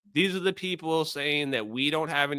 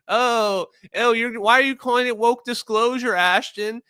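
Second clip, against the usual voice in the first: mocking whine; 9 semitones higher, 5 dB louder than usual. Mocking chat's teasing.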